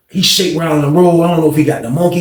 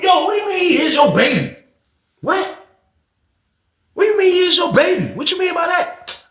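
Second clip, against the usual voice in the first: angry shouting; 13 semitones higher than usual. Retold or imitated. On the phone, yelling at Samantha over the baby, angry shout. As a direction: demand an answer, shouting in disbelief. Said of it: "I got the phone angry as hell".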